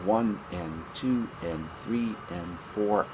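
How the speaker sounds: slow, soft, even counting of each syllable; eighth-note count. Shown as a demonstration. Teacher counting eighth notes slowly and softly. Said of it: "and you'll go".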